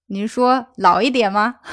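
usual speaking voice

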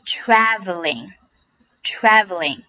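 "Traveling" very slow, with a wide-open æ; slow careful demo of "Traveling" with a full æ; open A vowel. Shown as a demonstration. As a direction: stretch "traveling", mouth wide on the first vowel. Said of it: "把嘴巴张大，".

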